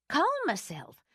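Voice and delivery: rising indignant outburst. Acted. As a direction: throw the words back, outraged. Anne repeats indignantly.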